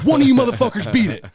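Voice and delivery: very loud, high taunting yell; pro-wrestler callout shout; wrestling-style challenge. Retold or imitated. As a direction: dare the whole field at full volume. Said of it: "the W W E game".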